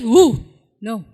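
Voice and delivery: ordinary talk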